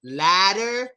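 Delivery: "Ladder" with a long, open A. Shown as a demonstration. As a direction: stretch the A in "Ladder". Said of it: "Open mouth on the A".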